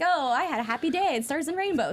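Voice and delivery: mock a cheery tweet in a put-on sweet voice; sarcastic singsong imitation of a too-happy post; mock Becky Lynch tweet. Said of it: "instead she's like".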